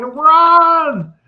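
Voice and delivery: high, loud, drawn-out shout; acted yell. Kid yelling at batter who just stands there, urgent shout. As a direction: holler it like a kid at a ballgame.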